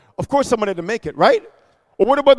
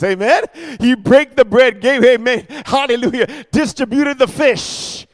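louder, higher preaching with amens; full-force preaching; 3 semitones higher, 6 dB louder than usual. Acted. Pastor to congregation, loud fervent preaching. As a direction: drive it home with fervor.

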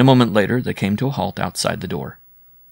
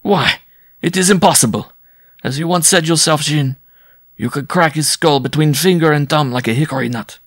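loud, high, emphatic disbelief; incredulous cry; 7 semitones higher, 5 dB louder than usual. A narrator's reading. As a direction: protest it loudly, amused and incredulous. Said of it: "the bareback rider cried".

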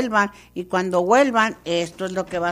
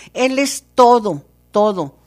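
preaching at full force; 8 dB louder than usual. Real, not acted. Preacher exclaims at peak.

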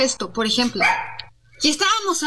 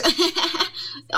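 a forced, higher laugh to fit in; fake laugh; 6 semitones higher than usual. Shown as a demonstration. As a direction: laugh late, without meaning it. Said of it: "obviamente no es espontáneo". (risa falsa y forzada)

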